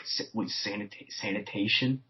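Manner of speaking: stammered, questioning SANITATION, rising at the end; low, halting repeat of SANITATION as a question; mock disbelief. Rebels question Finn's old job, quietly incredulous.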